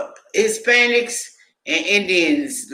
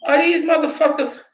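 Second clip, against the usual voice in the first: incredulous outburst; 9 semitones higher, faster than usual. Retold or imitated. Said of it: "And I was like".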